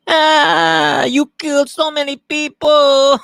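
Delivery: very high, loud, slow screechy put-on voice; mock terrified victim. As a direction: squeal in hammed-up terror. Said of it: "That's probably what he was doing".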